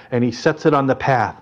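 usual speaking voice

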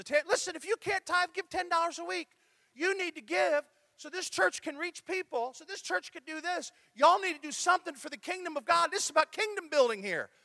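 high-pitched, insistent Texas twang; Tammy Young. Retold or imitated. Pastor imitates a colleague's giving appeal, Texas twang. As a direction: mimic a Texas woman exhorting in a twang. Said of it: "she said it in her Texas twangy voice".

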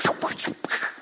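quick voiceless whooshing noises; imitated whoosh. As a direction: mimic a daring jump with breathy sound effects. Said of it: "adventurous people that would be like".

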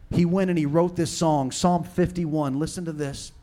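ordinary delivery

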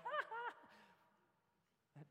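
high, soft taunting ha-ha; mock laugh. Tripping someone up on purpose, then a high mocking ha-ha. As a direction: laugh at them like a playground tease.

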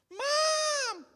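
put-on high, loud child's scream; lost child's piercing cry; child screaming Mom. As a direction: shriek for mom like a lost kid. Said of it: "And let that child scream".

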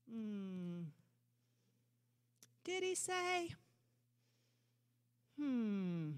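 mocker's voice. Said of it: "The voice of the mocker".

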